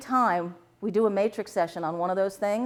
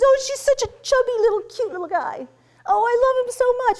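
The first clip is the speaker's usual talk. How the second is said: high-pitched adoring squeal of affection; mock gushing mother; gushing baby-cooing; 4 dB louder than usual. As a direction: coo over a chubby baby, pitched way up. Acted client cooing over the baby.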